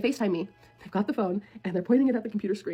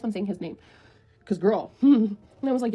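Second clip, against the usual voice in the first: usual speaking voice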